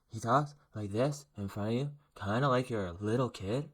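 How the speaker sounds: lightly imitating a politician, talking down as if to a child; Justin Trudeau speech voice. Commentator mocks Trudeau talking down to viewers, lightly imitated. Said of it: "his political speech voice".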